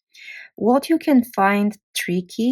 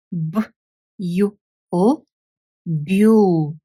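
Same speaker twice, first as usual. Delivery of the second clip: letters B, U, L, then "bule" said as byool; spelled-out Bulgarian syllable. Shown as a demonstration. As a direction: sound out then blend "bule". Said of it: "try to read it on its own first".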